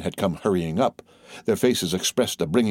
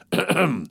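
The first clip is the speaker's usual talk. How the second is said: pompous mock throat-clearing; 9 semitones higher than usual. A narrator's reading. Colonel's throat-clearing, acted.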